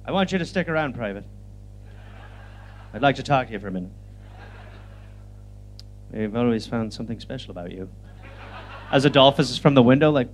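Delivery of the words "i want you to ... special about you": low, soft and intimate; low seductive whisper-talk. Pretend. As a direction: murmur it close like a seduction. Sergeant turns seductive, soft.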